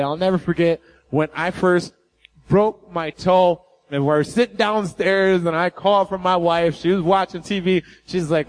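normal talking voice

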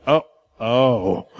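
low laughing oh; a low laughing gasp; wife's dismayed laugh. Retold or imitated. Wife seeing the broken toe, low laughing oh.

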